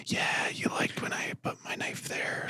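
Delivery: slow, breath-only whisper; mock ASMR whisper. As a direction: whisper it slowly, parodying murder ASMR. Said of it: "but everything was whispered".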